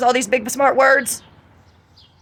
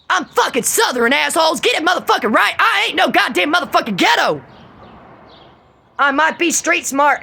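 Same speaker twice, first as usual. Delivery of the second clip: higher, shouted defiance; angry outburst; 6 semitones higher than usual. Real, not acted. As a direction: spit it out, furious.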